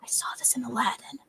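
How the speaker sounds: a quick whispered aside; fast, whispered joke to the table. Real, not acted. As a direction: slip it out under your breath. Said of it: "whispered aside".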